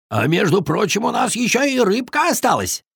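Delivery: high-pitched, pleased beaver; proud announcement. A narrator's reading. Beaver offers his leftover fish, proudly. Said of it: "гордо заявил бобёр".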